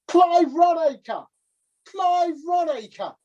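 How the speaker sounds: slow, high drawn-out call; shouted name. Acted. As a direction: call the name out slowly across the water. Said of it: "shout at the top of your lungs".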